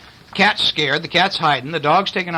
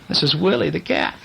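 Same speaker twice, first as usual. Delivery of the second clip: ordinary delivery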